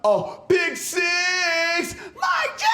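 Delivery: very high, drawn-out celebratory yell; excited shout. Acted. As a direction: celebrate the pick six. Celebrating a pick six.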